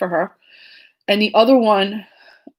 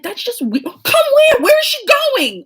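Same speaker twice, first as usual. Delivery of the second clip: much higher, louder, slowing on the last question; confused exclaim; 5 dB louder than usual. Retold or imitated. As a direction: blurt it out, completely baffled. Confused retelling to sister.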